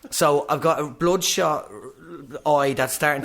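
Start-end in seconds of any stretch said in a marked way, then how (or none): none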